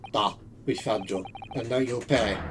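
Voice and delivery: low, soft threat; menacing officer. Acted. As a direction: lean in and promise punishment quietly. Streamer voices Russian officer.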